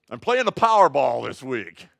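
loud playful boast; loud jokey aside; excited joke. Real, not acted. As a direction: shout the joke to the congregation. Joking boast in sermon.

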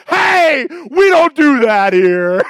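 outraged shout. Retold or imitated. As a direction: yell it like the deli will not serve ham. Clerk refuses ham order.

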